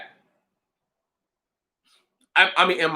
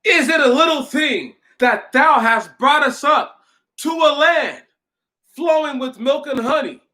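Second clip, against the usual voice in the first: pitched-up, indignant Bible complaint; challenging Moses; 11 semitones higher than usual. Acted. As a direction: read it as ungrateful people coming at their leader. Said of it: "listen to how they try to come at Moses".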